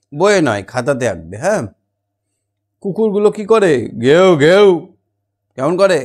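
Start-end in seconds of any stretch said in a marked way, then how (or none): 4.0-4.7 loud playful woof woof; mock barking. Shown as a demonstration. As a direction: bark like a dog for kids. Imitating dogs barking. (কুকুরের মতো ঘেউ ঘেউ)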